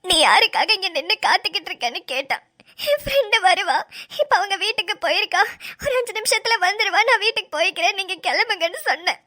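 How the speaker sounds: tearful retelling; sobbing. Acted. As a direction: recount it while weeping. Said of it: "தேம்பி தேம்பி அழுதுனா".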